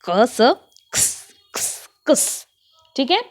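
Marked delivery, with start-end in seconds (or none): 0.9-2.4 क्स as a soft breathed k-s with no vowel; ending sound demo. Shown as a demonstration. Teacher isolates the ending sound for pupils, soft k-s. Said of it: "इस तरह से लिख सकते हैं".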